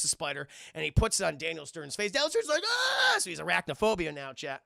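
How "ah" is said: very high, loud shriek; mock terrified scream. Burglar with a spider on his face, mock terrified scream. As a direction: shriek in hammed-up panic.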